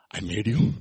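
low, gentle and measured; tender declaration. Acted. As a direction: say it intimately, as the maker. Jesus voiced, tenderly addressing us.

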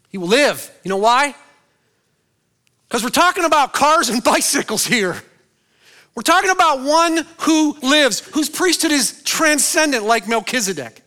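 high, loud, driving preacher voice; full-force preaching. Acted. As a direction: hammer it home from the pulpit. Preacher climaxes on resurrection.

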